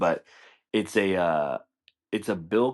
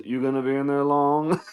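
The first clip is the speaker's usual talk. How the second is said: mock-casual creepy question; Bill Cosby; 7 semitones higher, 3 dB louder than usual. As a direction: lightly imitate a nosy comedian. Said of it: "Bill Cosby looking over a toilet stall".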